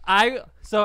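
ordinary delivery

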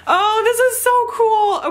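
very high, gleeful gushing; thrilled squeal. Real, not acted. Vlogger gushing over shoe details to viewers, thrilled squeal. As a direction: squeal it with delight.

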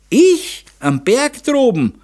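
very high, astonished questioning; incredulous. A narrator's reading. Daughter answers father's charge about the mountain, incredulous. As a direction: echo the charge in disbelief. Daughter feigns surprise at accusation.

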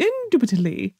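quick, mock-British INDUBITABLY with a swooping lilt; slight British accent. Shown as a demonstration. Streamer trying the word the specific way, slightly British. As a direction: put a touch of British on INDUBITABLY. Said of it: "You have to put, like, a slight British accent".

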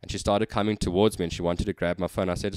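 usual speaking voice

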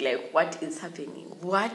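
loud, indignant-sounding shock; acted disbelief. Student shocked by the cane, acted disbelief. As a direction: blurt it loudly, ending on a sharp "what".

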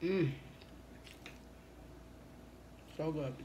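talking with mouth full; eating while speaking; chewing food. Real, not acted. Seen 2: Fork to mouth at 1.3, chewing throughout labelled words.

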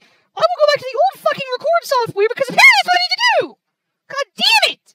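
high, loud, fast ranting; real furious outburst. Real, not acted. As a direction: rant up to a yelled curse. Angry end of ruined recording.

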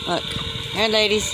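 high, sing-song chicken call. Real, not acted. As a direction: coax the hens over. Calling the chickens.

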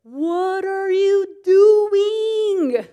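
very high, loud, slow drawn-out divine rebuke; the Lord scolding. Retold or imitated. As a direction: stretch it out like God catching you red-handed. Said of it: "when the Lord goes".

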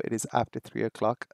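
normal talking voice

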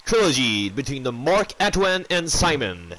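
slow, drawn-out boxing ring intro; ring announcer. Acted. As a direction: announce it like a fight night MC.

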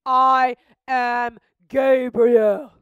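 loud, very slow booming declaration; mighty angel. Acted. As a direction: proclaim your name with power. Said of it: "The angel said".

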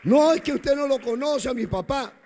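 loud, high objection in another's mouth; mimicked protest. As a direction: protest loudly as the complaining son. Voices an objecting church member.

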